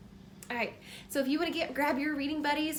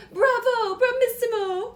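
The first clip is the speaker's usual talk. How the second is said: much higher, louder delighted cheer; cheering assistants; 10 semitones higher, 11 dB louder than usual. Acted. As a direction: cheer the hat like an opera crowd.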